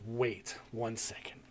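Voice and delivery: guy calmly excusing himself, low quiet murmur; low, quiet and slow. Retold or imitated. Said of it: "He goes".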